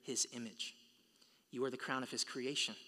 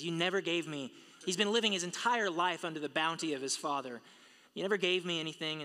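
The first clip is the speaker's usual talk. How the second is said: higher, louder, hammering never; heated mockery; 5 semitones higher, 7 dB louder than usual. Preacher hammering the son's complaint, heated and loud. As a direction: build the outrage with each repeat. Repeated mocking complaint, rising heat.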